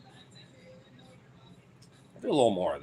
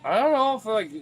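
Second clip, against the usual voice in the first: much higher, louder exclamation; sudden outburst; 12 semitones higher, 7 dB louder than usual. Real, not acted. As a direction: burst out in exasperation. Sudden outburst after pause.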